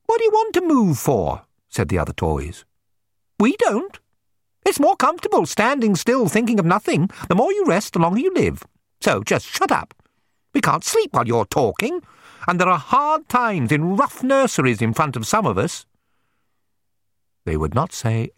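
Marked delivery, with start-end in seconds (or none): 0.1-1.5 very high toy voice, querying; grumbling toys. A narrator's reading. Other toys answer the restless dog, grumbling. 3.4-15.9 high-pitched toy voice, complaining; grumbling toys. A narrator's reading. Other toys tell the dog to shut up, grumbling.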